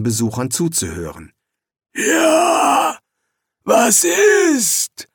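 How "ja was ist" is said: demon voice. Acted. Demon answers visitors.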